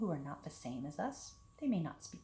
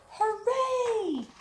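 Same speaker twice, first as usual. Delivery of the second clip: high, loud cheer; cheering shout; 11 dB louder than usual. A narrator's reading. Storybook taste buds cheering at lunchtime, high loud cheer. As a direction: shout it like happy kids cheering. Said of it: "our taste buds shout".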